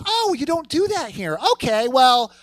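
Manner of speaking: high, polite, breezy ordering voice; suddenly sane customer. Retold or imitated. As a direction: act out a man instantly reasonable and agreeable. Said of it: "the dude just snaps out of him".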